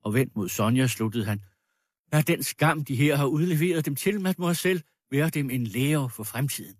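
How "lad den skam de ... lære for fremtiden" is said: raised, emphatic admonition; lightly voiced pompous lecturer; stern moralizer. Accuser admonishing the shamed girl, sternly.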